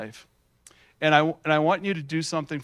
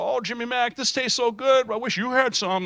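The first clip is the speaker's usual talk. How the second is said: high, sing-song taunt, much higher; teasing friends; 9 semitones higher, 4 dB louder than usual. Retold or imitated. Friends at lunch taunting a dieting boy, high mocking sing-song. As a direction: rub it in like a smug friend at lunch. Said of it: "they'd rib me".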